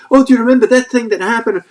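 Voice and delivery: high, loud reproachful wife; wife's voice. Retold or imitated. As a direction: dredge up old grievances in a high, loud voice. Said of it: "She came up with all sorts".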